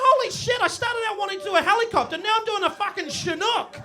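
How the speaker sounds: high, fast alarmed outburst; shocked. Retold or imitated. Man discovering his nutsack spinning too, shocked. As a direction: blurt it out in panic. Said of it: "It's like".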